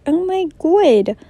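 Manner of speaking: put-on high, loud Australian accent; hammed-up Australian cry of astonishment; bad Australian accent. Boys gather after the crash, loud Australian accent. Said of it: "that's Australian".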